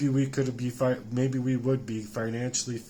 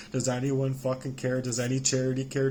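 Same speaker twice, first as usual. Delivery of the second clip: ordinary delivery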